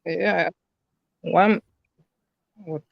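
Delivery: choppy, breaking-up call audio; glitchy robotic call. Real, not acted. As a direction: voice cutting out over a bad line. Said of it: "Your audio is breaking up a little bit".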